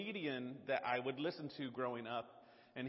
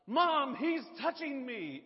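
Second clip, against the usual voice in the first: high, loud, slow whine; whiny tattling little sibling; 10 semitones higher, 10 dB louder, much slower than usual. Retold or imitated. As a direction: whine to Mom like a little kid. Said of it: "finally he was like".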